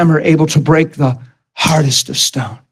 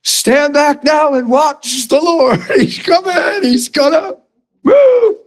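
much higher, slow, shouted waiting-on-God voice; mock Moses; 9 semitones higher, slower than usual. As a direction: ham up a passive Moses calling out. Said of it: "he does what we do".

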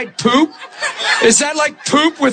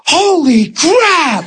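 high, slowly stretched exclamation; mock amazement; 5 semitones higher, much slower than usual. Onlooker sees a lady's leg grow out, mock amazed cry. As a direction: draw it out in imitated astonishment. Retold lady exclaims at a healing.

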